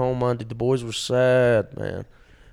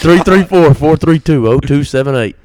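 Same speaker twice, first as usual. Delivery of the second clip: much louder, emphatic number readout; loud repeat; 3 semitones higher, 13 dB louder than usual. Pretend. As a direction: belt out each digit group loudly. Phone number repeated after check again.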